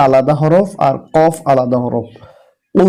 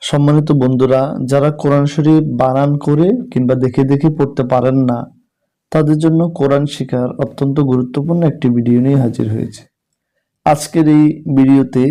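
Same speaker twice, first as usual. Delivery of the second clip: ordinary talk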